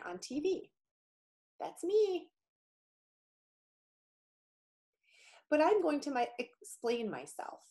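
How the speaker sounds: lightly voiced friendly virus; a touch of a cute little germ. Virus story continues.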